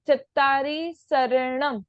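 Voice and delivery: slow शरणम opening with a plain "s"; शरणम drawn out, dental s at the start; "sa" for "sha". Shown as a demonstration. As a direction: say शरणम as sa-ra-nam. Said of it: "शरणम नहीं".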